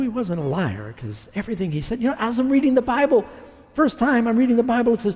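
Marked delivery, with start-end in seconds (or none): none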